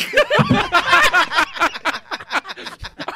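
big burst of laughter; howling laugh; loud laugh. Real, not acted.

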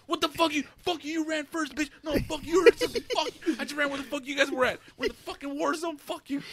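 hammed-up blame game between buddies; mock bickering. Sailors blaming each other for running first, mock bickering. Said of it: "And we're talking".

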